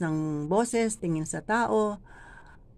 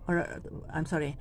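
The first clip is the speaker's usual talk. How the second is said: ordinary talk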